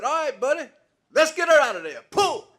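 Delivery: loud high call; calling out to the mule. Retold or imitated. Old farmer shouting to his mule to pull the car, loud call. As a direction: shout the command across the field.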